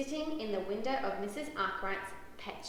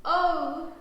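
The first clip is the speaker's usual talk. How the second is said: loud, higher exclamation; excited cry; 5 semitones higher, 8 dB louder than usual. A narrator's reading. Lark sees the puppy yawn, crying out. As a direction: cry out in amazement. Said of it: "cries Lark".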